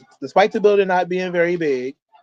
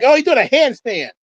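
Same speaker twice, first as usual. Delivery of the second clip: high, loud burst of amazement; excited reaction; 10 semitones higher, 5 dB louder than usual. Real, not acted. Wrestling fan watching Bulldog do a handstand, excited outburst. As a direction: exclaim it in sudden real excitement.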